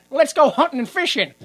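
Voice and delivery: mock cheery rallying. Acting out callous townsfolk.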